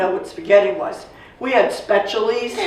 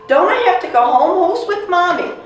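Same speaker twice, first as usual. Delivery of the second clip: put-on much higher, louder anxious little-girl question; worried child; 10 semitones higher, 4 dB louder than usual. Child worrying about sick mother.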